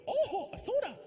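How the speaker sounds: rising high with glee; light up at the soda; delighted soda surprise. Real, not acted. Comedian spotting a soda, high and delighted.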